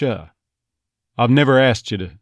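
slow Texas line with "asked you" run into "ch"; slow Texas accent demo. Shown as a demonstration. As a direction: blend "asked you" into "aschew" slowly. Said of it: "it might be more like a ch".

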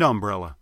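"umbrella" as UM-brella, first syllable stressed; shifted Southern stress. Shown as a demonstration. Dialect coach demonstrates Southern stress, "umbrella" said UM-brella. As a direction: punch the UM of "umbrella". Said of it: "the stress on words can actually shift around".

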